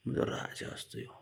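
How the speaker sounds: soft unvoiced fond whisper; tender whisper. Real, not acted. Praising her little king in a tender whisper. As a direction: whisper it as a loving secret. Fondly praising the sulking boy.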